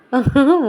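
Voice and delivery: a loud, high laugh bursting out; burst of laughter. Real, not acted. Vlogger cracking up at the cold drink fiasco, loud laugh.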